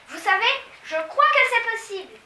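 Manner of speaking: high, loud excited cry; excited high girl's cry; exclaiming. A narrator's reading. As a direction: burst out with hope. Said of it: "s'écria Mathilda".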